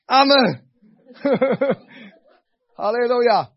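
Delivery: high, loud mock amen; mock husband agreeing; husband's amen. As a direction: answer like a husband giving in.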